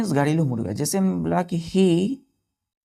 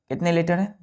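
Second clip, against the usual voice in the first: ordinary delivery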